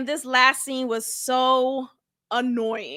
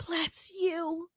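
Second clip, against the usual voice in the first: imitating a whisper through held-back tears; mock teary whisper; whispered bless you; 11 dB quieter than usual. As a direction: breathe it out tearfully. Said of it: "she's like".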